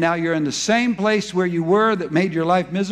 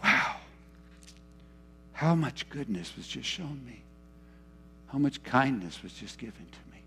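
much softer, lower, hushed wonder; awed soft reflection; 7 semitones lower, 8 dB quieter than usual. Retold or imitated. Convicted heart reflecting on God's kindness, hushed awe. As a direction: murmur it in humbled awe. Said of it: "to go".

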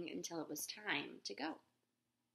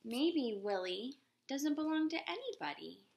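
put-on higher, louder hopeful girl; girl wondering aloud on the way home; girl's voice; 6 semitones higher, 5 dB louder than usual. A narrator's reading. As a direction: lift it up like a child. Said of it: "the girl said".

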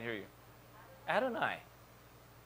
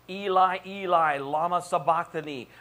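loud, raised cry in Aramaic; crying out from the cross; 4 semitones higher, 7 dB louder than usual. Acted. Preacher voicing Jesus hanging on the cross, loud cry. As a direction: call it out loudly as an anguished plea. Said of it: "while hanging on the cross".

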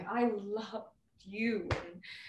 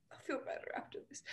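rushed, soft whisper; fast hushed whisper fighting tears; tearful whisper; 5 semitones higher, 13 dB quieter, much faster than usual. Acted. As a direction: hurry the words out under your breath. Holding back tears.

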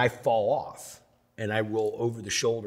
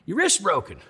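much higher, matter-of-fact doc; high blunt small-town doctor diagnosing a kid; country doctor; 12 semitones higher, 6 dB louder than usual. Retold or imitated. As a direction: deliver the news like the old town doc. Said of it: "And he goes".

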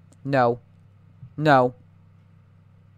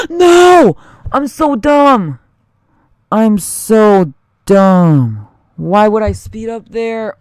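loud, high cry of self-reproach; anguished shout; 8 semitones higher, 13 dB louder, much slower than usual. Real, not acted. Gamer shouting in anguish after dying in the game. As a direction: yell at yourself after a blunder.

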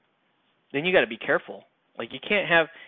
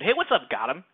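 田舎者の口真似; 6 semitones higher, 3 dB louder than usual. Acted. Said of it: "like, a yokel hick dude".

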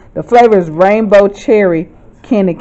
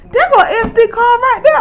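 much higher, thrilled cry of discovery; high, overjoyed retelling; excited exclaim. Retold or imitated. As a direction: shout the find with joy. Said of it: "I said".

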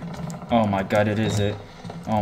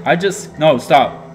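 startled; 5 semitones higher, 8 dB louder than usual. Real, not acted. Startled by creepy figure.

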